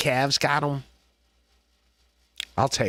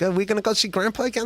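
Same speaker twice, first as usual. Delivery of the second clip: put-on excited kid; 7 semitones higher, faster than usual. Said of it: "I was excited to go see my grandfather".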